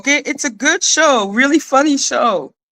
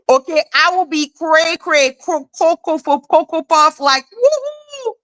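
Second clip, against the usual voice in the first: hammed-up crazy; 3 semitones higher than usual. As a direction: go full cartoon loony. Hamming up crazy.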